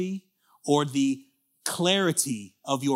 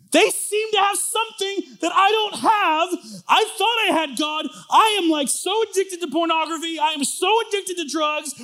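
high, loud, driving sermon voice; fervent preaching; 12 semitones higher, 8 dB louder than usual. Acted. Pastor pressing the crowd about others' faith, preaching at full force. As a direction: preach it hard, building fire.

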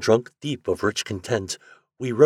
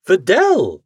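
higher, louder cry of her name; alarmed cry; 10 semitones higher, 8 dB louder than usual. A narrator's reading. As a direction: cry out in protest. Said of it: "Again I cried".

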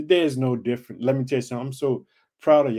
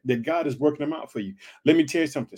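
normal talking voice

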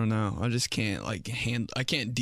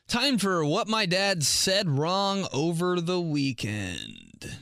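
slow, higher announcer read; segment announcer; 6 semitones higher, slower than usual. Pretend. Vlogger announcing her weekend segment, announcer voice. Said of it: "announcing a segment title".

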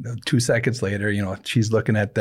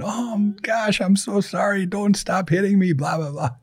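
high pitched pained wail of an apology; mock howling; 10 semitones higher than usual. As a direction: wail it like a grandpa being hit, much higher. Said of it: "he's just howling".

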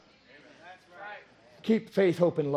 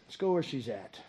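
quiet calm Jesus; 4 semitones lower, 7 dB quieter than usual. Retold or imitated. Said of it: "he said".

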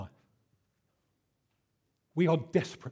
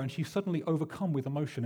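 ordinary talk, nothing marked

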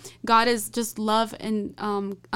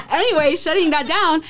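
higher and louder through giggles; real flustered laughter; laughing it off; 8 semitones higher, 6 dB louder than usual. Real, not acted. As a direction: brush off the tears with a laugh. Laughs off nearly crying mid-testimony.

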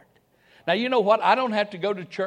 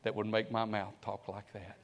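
humble prayer; 8 semitones lower, 9 dB quieter than usual. Retold or imitated. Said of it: "bowed your head and say".

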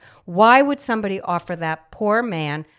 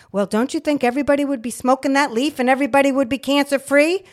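ordinary delivery

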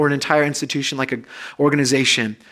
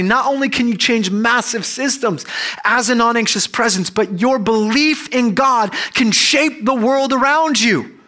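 impassioned preaching; 5 semitones higher than usual. Real, not acted. Pastor rallying the congregation, impassioned preaching. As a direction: preach it out, lifting the room.

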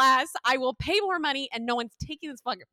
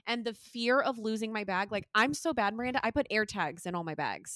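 normal talking voice

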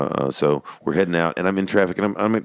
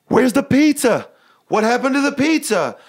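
hammed-up kid's outcry, pitched way up; Jerry Lewis; 4 dB louder than usual. Son hamming it up to dad over his plate, mock wailing. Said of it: "like in this Jerry Lewis".

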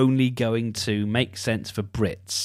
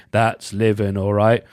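band chip-in response; 2 semitones lower than usual. Retold or imitated. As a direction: answer the hook like lads chipping in. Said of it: "Then you hear".

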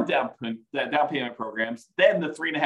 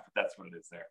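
ordinary talk

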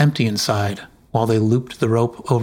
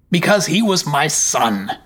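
Jarl snaps back at his wife, angry and raised; 5 semitones higher than usual. A narrator's reading. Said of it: "said the Jarl angrily".

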